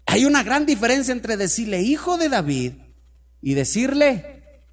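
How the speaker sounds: loud, high preaching at full force; fervent preaching. Real, not acted. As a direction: drive the story home to the congregation. (predicación ferviente y a todo volumen)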